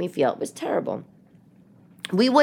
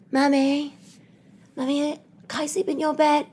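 put-on little girl, gentle; 9 semitones higher than usual. Young daughter asks mommy at night, gentle and high. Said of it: "they knock on my door ever so gentle".

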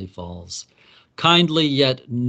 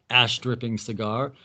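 usual speaking voice

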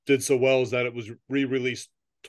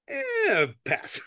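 dismissive. Retold or imitated. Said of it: "she's like".

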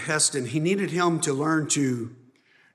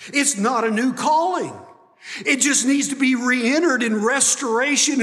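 loud, raised preaching to the congregation; full-force preaching; 8 semitones higher, 6 dB louder than usual. Acted. Pastor preaching to the church, loud and driving. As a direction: preach it out with fire and conviction.

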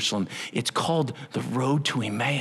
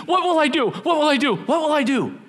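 panicked worrying; 12 semitones higher, 4 dB louder than usual. Acted. Voicing panicked worrier.

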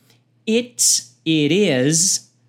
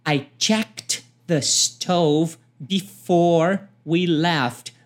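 slow, careful "I checked the stove before we left"; slow teaching demo; slow model line; much slower than usual. Shown as a demonstration. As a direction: spell it out slowly for learners. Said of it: "Então".

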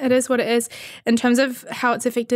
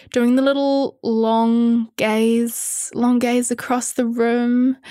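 1 slow lingering; much slower than usual. Shown as a demonstration. Demonstrating long gaze.

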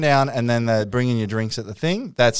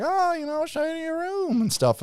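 put-on much higher, softer American country accent; country American woman; 7 dB quieter than usual. American woman showing guests their room, high country accent. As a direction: imitate a country-ish American woman, pitched way up. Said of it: "very American, like, country-ish woman".

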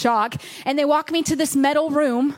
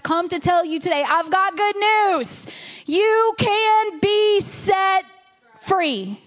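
rising, stretched-out pulpit declaration; preaching proclamation; 4 semitones higher, slower than usual. Real, not acted. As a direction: proclaim it high and slow, then drop on the last word. Preacher proclaims good news.

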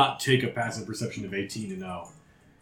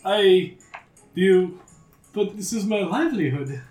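high, loud, stammering fear; frightened stammering; 9 semitones higher, 4 dB louder, slower than usual. Acted. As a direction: stutter out a desperate protest. Threatened dealer stammers.

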